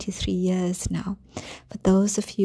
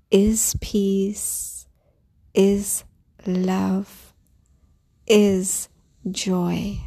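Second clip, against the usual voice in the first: calm grounding exercise. Acted. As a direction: guide them gently into calm. Leads a grounding meditation.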